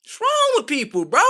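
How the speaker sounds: high, loud cry of disbelief; fed-up shout; exasperated yell. Real, not acted. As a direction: throw it to the heavens. Ranting about her order.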